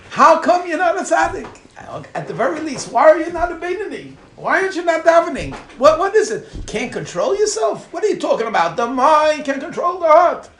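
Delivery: loud, high hectoring rebuke; blunt scolding. Retold or imitated. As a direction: hammer out indignant questions, acting the stern critic. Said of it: "they mince no words".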